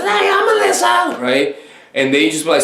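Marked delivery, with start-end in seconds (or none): 0.0-1.2 high, loud squeal of a greeting; shrieky caricature falsetto; mock woman's reply. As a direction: imitate her in an exaggerated high voice. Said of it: "She says".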